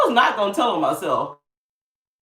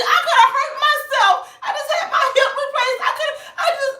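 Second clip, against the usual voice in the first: whiny lady. Retold or imitated. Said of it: "she said".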